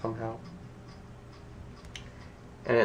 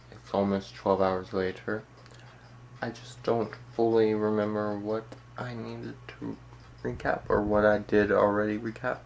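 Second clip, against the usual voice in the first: normal talking voice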